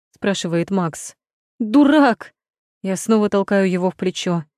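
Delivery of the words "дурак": high, loud furious shout; angry shout. Acted. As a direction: yell it at him in fury. Angry girlfriend scolding him.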